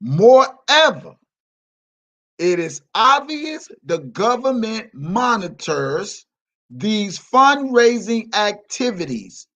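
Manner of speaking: slow, deliberate read, every word spaced out; slow mocking read to the chat. Shown as a demonstration. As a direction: spell it out slowly for slow listeners. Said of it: "let me read this again to you slow ass motherfuckers".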